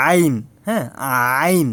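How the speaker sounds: আইন said hard from the throat, like a short scold; আইন with a mild scolding force. Shown as a demonstration. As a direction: bark out আইন as if scolding a little. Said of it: "কেমন যেন একটু ধমক দিচ্ছি".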